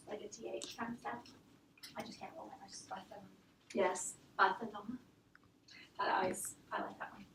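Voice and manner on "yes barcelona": usual speaking voice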